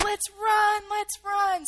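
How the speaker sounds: high, loud retold cry; urgent call. Leader to group behind the soldiers, high urgent retold call. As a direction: imitate a panicky leader urging flight.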